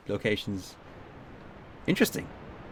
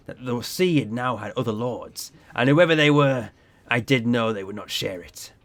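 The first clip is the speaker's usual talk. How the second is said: storytelling sea captain; 2 semitones lower than usual. Acted. Captain recalls the voice at sea, ominous yarn. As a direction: spin an eerie tale, lightly in character. Captain continues his tale.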